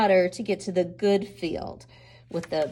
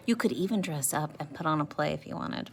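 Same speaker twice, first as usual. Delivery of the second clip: ordinary talk, nothing marked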